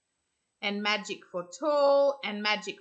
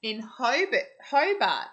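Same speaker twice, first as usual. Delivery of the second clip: plain reading voice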